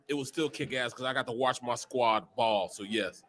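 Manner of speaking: normal talking voice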